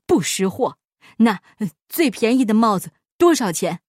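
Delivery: lightly voiced huffy old master; loud, grudging, hesitant question; scoff, then ask grudgingly; grumpy miser. Insulted miser haggles with shopkeeper.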